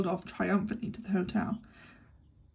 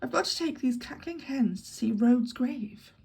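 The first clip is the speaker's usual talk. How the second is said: ordinary delivery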